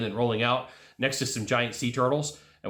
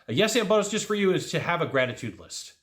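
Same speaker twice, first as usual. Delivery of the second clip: ordinary delivery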